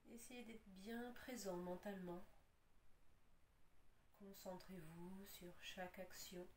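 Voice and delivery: calm, unhurried yoga nidra guidance with long pauses; guided relaxation. Acted. Yoga teacher settles students lying on their backs, guided relaxation. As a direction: ease them into rest, leaving space between instructions.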